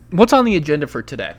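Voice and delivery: normal talking voice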